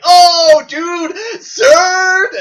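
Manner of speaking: loud high whooping yell; roaring crowd shout. Retold or imitated. Drunk frat bros howling at the burn, loud shout. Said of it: "lose their shit laughing, like".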